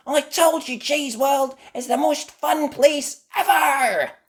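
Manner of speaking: very high, loud, slow; triumphant cry. Acted. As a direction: gloat happily. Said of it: "cried Mandy".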